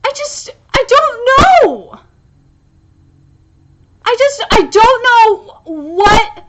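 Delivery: slow, high, loud near-wail; distraught. Real, not acted. Fan fearing how the manga ends, distraught. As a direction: wail it out, at a loss.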